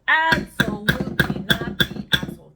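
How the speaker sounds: "Absolutely" hit hard and loud; emphatic repeat. Real, not acted. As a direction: declare it for the whole room. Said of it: "repeated for emphasis".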